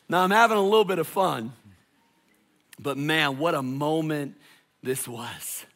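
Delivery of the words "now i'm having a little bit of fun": ordinary delivery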